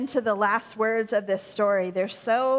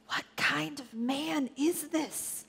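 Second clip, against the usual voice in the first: soft, slow, higher-pitched awed question; anxious wondering; 4 semitones higher, 5 dB quieter, slower than usual. A narrator's reading. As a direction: ask it under the breath, uneasy. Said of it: "they asked themselves anxiously".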